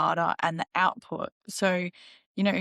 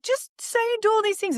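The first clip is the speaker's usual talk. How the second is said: lightly mocking the fluffy advice, pitched high; a touch of mimicry, voice jumping up; mild high send-up of the industry's advice; mocking quote; 14 semitones higher than usual. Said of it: "people are like".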